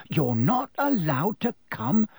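high, aghast question; horrified. A narrator's reading. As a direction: ask in horrified disbelief. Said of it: "Ron looked horrified".

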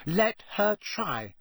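slow, high, defiant challenge; defiantly. Acted. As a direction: dare her, drawn out. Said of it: "said Hermione defiantly".

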